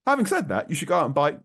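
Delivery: high, loud mock advice; joking. Host teasing that they should go out and buy, joking. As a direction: pitch it high and loud as a tease. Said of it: "no, I'm joking".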